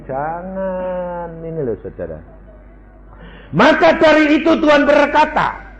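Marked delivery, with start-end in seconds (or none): 3.5-5.7 high, loud, slow proclamation; preaching at full force. Acted. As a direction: proclaim the Scripture to the crowd. Preaching Scripture loudly.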